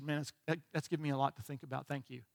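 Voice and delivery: very soft, low imitation of a moved woman; retold tearful thanks. Waitress thanks the preacher for his word, retold hushed and tearful. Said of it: "And she's crying".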